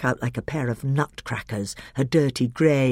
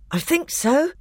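panting; 14 semitones higher than usual. A narrator's reading. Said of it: "panted Edmund".

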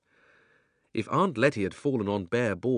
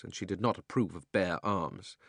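usual speaking voice

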